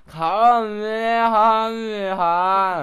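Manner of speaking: put-on very loud, high, hugely stretched American-accent yell; American-accented attack call. Showing the host the American version, hammed-up American accent. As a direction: stretch every syllable in a mock American bellow. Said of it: "アメリカ版は、".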